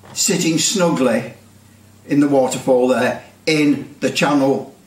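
normal talking voice

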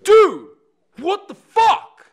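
huge high yell, slow and drawn out; acted-out furious shout; Ben Affleck outraged. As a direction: explode in mock outrage. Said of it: "So Ben Affleck goes".

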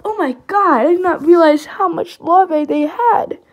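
loud, high burst of amazement; excited shout. Real, not acted. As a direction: blurt it out, thrilled. Shocked at the ant larvae.

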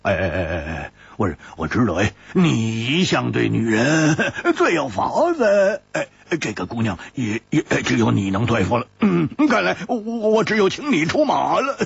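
butter up your friend, grinning; fawning, chuckling coax; ingratiating grin. Acted. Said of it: "陪笑说".

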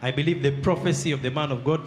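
ordinary talk

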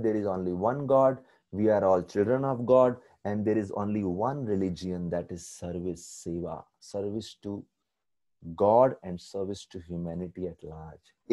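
preacher stating the moral; solemn conclusion. Acted. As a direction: lay down the final truth slowly. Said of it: "हमारा conclusion".

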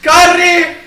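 high, loud urgent yell; panicked shouting. Real, not acted. Player following a friend as they flee, panicked shouting. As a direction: shout to a partner to run. Fleeing the killer in game.

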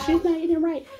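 high, loud mock-indignant critic voice; mocking the crawfish fans. Vlogger mimicking the annoyed crawfish eaters watching her, high and loud mock scolding. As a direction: sneer it like an offended crawfish fan. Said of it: "I know y'all mad. Like".

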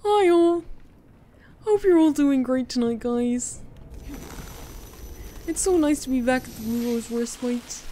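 viewer-picked high novelty voice; requested silly voice. Acted. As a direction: greet the chat in a squeaky cartoon voice. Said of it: "Scarlet has redeemed to pick my voice".

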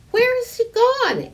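put-on high, loud worried child; a little girl calling out in alarm; child's voice. A narrator's reading. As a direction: Harriet asks where the tree went, high and loud. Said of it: "asked Harriet".

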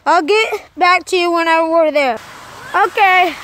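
usual speaking voice

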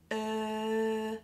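long, level "Er" with no R; individual sound. Shown as a demonstration. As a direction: hold "Er" steady and forward, lips out. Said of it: "hitting each of those individual sounds".

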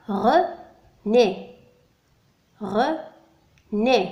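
René split into two syllables, "re", pause, "né"; René pronounced in separated, careful syllables. Shown as a demonstration. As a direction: say René slowly as re... né. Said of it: "نسمع طريقة نطق الكلمة".

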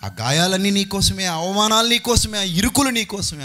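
impassioned sermon litany; passionate preaching. Acted. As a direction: climb with each నీకోసమే.

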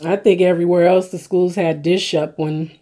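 eating a burger while talking; talking while chewing. Real, not acted. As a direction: mouth partly full of food. Seen 0: Holds burger at mouth, chewing between words throughout.

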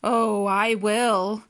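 slow, drawn-out shark sneer. A narrator's reading. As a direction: sneer it slowly at the pleading fish. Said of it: "sneered the shark".

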